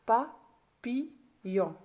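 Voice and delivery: PAPILLON very slowly, in three separate beats pa, pi, yon; split into syllables. Shown as a demonstration. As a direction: say "papillon" syllable by syllable with clear pauses. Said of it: "J'ai séparé le mot en syllabes".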